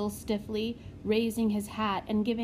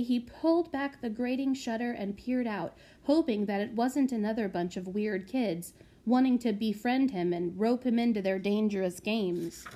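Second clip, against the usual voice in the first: usual speaking voice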